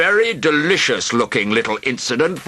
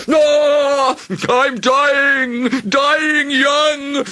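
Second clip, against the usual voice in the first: high, loud, slow wailing dragon; yammering yell; 6 dB louder, much slower than usual. A narrator's reading. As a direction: wail dramatically as if dying. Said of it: "a terrible yammering yell".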